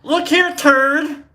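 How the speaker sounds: high, loud hammed-up insult; cartoonish mock fury; mock angry insult. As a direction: snap the insult like a playground taunt. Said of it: "Let's test it".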